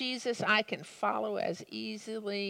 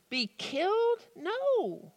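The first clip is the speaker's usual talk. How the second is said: hurt disbelief; 10 semitones higher, slower than usual. A narrator's reading. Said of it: "His feelings were so hurt".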